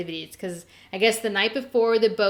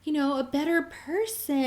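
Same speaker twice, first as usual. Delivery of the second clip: exasperated pleading; 8 semitones higher than usual. Retold or imitated. Host scolding Grams over doubting Jen, exasperated pleading. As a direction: plead with rising exasperation, stretching key words.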